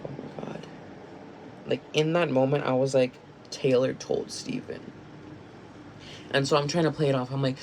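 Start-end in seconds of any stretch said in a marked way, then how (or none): 0.0-0.7 breathless whispered "oh my God"; shocked whisper. Retold or imitated. Realizing Taylor told Steven. 3.5-4.9 slow whispered realization; stunned whisper. Retold or imitated. Silent realization at work.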